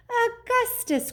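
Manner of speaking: high, drawn-out cry of greeting; very high, delighted storybook exclamation; excited cry. A narrator's reading. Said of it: "cried Mister Wonka".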